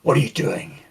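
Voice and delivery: low, menacing question from a dark-side user; deep, controlled demand; interrogator. Acted. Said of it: "Walk up to it and say".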